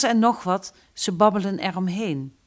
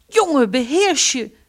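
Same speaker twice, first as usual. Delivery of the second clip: louder, higher sharp rebuke; reprimanding; 7 semitones higher, 6 dB louder than usual. Acted. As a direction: scold him to control himself. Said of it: "berispt zijn moeder hem".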